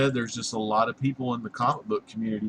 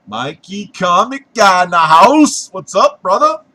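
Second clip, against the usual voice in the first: shout-out; 6 semitones higher, 13 dB louder than usual. Real, not acted. Calling out a viewer.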